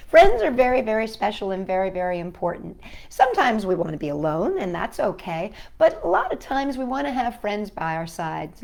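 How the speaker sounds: usual speaking voice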